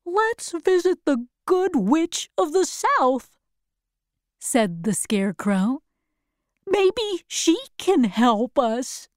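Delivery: high-pitched Scarecrow voice; helpful Scarecrow, suggesting. Acted. Scarecrow's lines in story.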